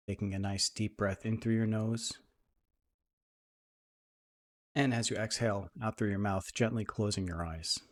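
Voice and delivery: soft, even meditation voice with long pauses; guided meditation. Acted. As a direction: ease listeners into calm, leaving space between phrases.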